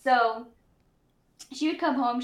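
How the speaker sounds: usual speaking voice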